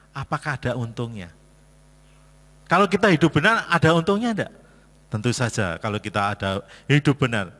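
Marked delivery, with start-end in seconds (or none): 2.7-4.5 higher and louder the second time; emphatic repeat. Acted. Preacher pressing the congregation with the question.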